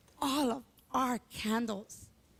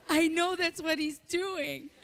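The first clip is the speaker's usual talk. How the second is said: normal talking voice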